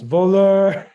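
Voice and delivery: a loud, high yell of Voleur; yelled accusation. Retold or imitated. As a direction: shout Voleur at the thief. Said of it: "he just yells".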